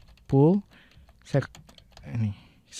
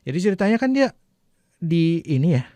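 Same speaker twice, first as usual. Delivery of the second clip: normal talking voice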